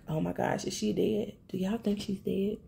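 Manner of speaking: softly retold gasp of worry; hushed shock. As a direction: drop the volume, as if dreading the answer. Shocked reaction to the beating scene.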